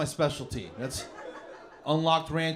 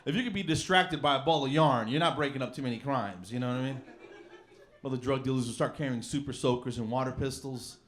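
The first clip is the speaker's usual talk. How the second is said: ordinary talk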